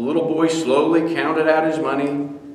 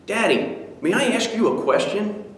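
put-on high child's voice; little boy; 8 semitones higher than usual. Son greeting his tired father, high child voice. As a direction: lift into a small boy asking.

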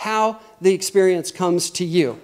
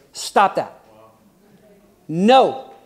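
acted stern order, louder on the refusal; very strong command; 4 dB louder, much slower than usual. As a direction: forbid it firmly, playing the bossy mind. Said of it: "Very strongly".

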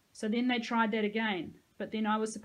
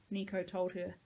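ordinary delivery

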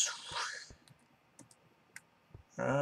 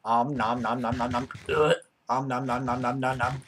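nom nom chomping; 3 dB louder than usual. Pretend. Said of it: "I'm getting hungry as hell".

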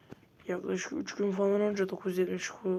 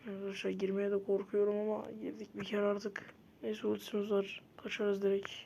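ordinary delivery

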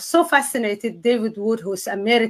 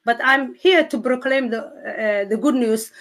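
usual speaking voice

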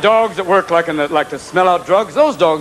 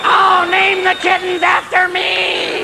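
cat in heat; 12 semitones higher, much slower than usual. Acted. Cat mating under bedroom window, ecstatic yowling. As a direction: wail in mock ecstasy, pitched way up. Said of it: "Sitting under there going".

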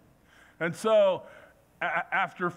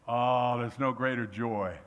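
ordinary talk, nothing marked

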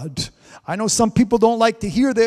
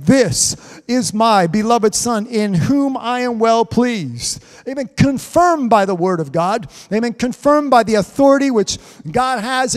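fervent preaching; 3 semitones higher, 4 dB louder than usual. Real, not acted. Preaching the baptism scene.

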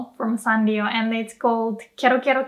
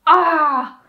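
exasperated; 5 semitones higher, 6 dB louder than usual. Shown as a demonstration. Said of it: "when you're frustrated".

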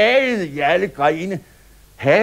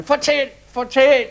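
high, loud clamour; shouting; 12 semitones higher, 7 dB louder than usual. Acted. Clapping children shouting for more of the story, high loud call. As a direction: demand more like eager kids. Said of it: "råbte".

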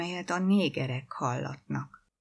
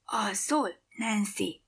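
higher-pitched hushed question; whisper; 4 semitones higher than usual. A narrator's reading. Keddi checks whether Nancy is asleep, whispering. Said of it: "suttogta Keddi".

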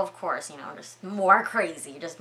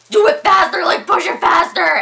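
high, loud panicked shout; frantic urging; 13 semitones higher, 13 dB louder than usual. Real, not acted. Storyteller urging Michelle to hide the suit, frantic. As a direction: yell it like urging on a screen character.

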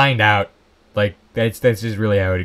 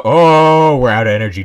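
loud alarmed outburst; shocked the power is gone; 3 semitones higher, 8 dB louder than usual. Real, not acted. Power suddenly runs out.

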